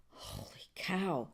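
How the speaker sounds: low, soft slow gasp; quiet stunned holy cow; hushed amazement. Real, not acted. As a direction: breathe it out amazed. Sees spinner result.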